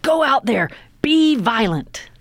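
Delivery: high, loud, intense locker-room urging; coach's pep talk. Retold or imitated. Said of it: "and he's saying".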